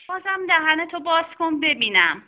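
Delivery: a brisk doctor examining a patient; doctor's voice. Acted. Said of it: "خانم دکتر گفت".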